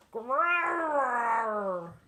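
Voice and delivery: big rolling growl; loud bear growl. A narrator's reading. The bear growls back at Baba Brena, loud rolling growl.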